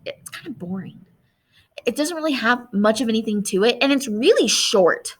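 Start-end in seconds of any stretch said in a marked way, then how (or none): 0.1-1.0 whispering to herself; under her breath; whispered aside. Real, not acted. Aside while rereading essay.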